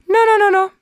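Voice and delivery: high, loud no no no; emphatic no. Real, not acted. Correcting her viewers, a loud high-pitched no. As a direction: shoot the idea down loudly. Denying the audience's guess.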